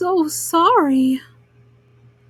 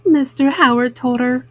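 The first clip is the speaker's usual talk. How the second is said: plain reading voice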